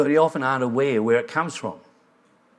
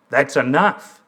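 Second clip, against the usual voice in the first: firm, louder retold demand; bolder; 5 dB louder than usual. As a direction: speak up boldly to cut it off. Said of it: "he said more boldly".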